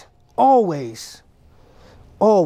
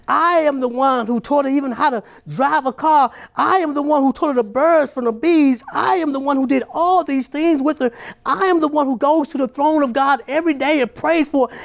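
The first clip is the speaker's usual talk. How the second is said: preaching; 5 semitones higher than usual. Acted. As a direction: climb to the hurt father's indignant peak. Preaches the wounded father's speech.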